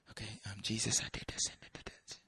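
whispered, mumbled prayer; soft, secretive mock prayer; hushed private confession. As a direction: confess under your breath. Acting a private confession alone.

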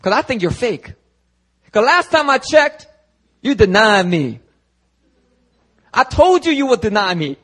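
high, loud confrontation; acted accusing. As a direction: challenge them as if catching a fake. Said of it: "The question isn't".

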